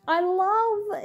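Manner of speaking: drawn-out, high-pitched adoring stress; gushing. Real, not acted. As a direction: stretch it high with delight. Gushing over the narrator's impression.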